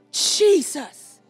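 ordinary talk

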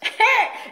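quick high laugh; light high giggle; amused laugh. Real, not acted. Laughing after own line.

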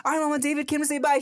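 put-on high, quick talk to a child; eager dad. Dad tells daughter David came back, eager and high. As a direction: chirp it eagerly to a little kid.

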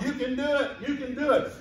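mock crowd shout, pitched high; crowd cheering on. Crowd to tightrope walker, shouting encouragement. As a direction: urge him on like an excited crowd. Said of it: "were shouting him on".

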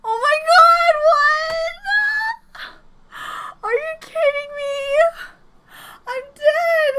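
very high, emotional squeal; real high-pitched, overcome reaction; overwhelmed fangirl outburst. Real, not acted. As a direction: wail in disbelief at the ending. Overwhelmed when the video ends.

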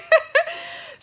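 bursting into a high laugh; loud laugh. Real, not acted. Laughs after imagined thought.

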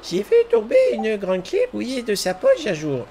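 put-on high, old lady voice for a girl; grandmother voice. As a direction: play her like a granny. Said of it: "Je lui donne une voix de grand-mère".

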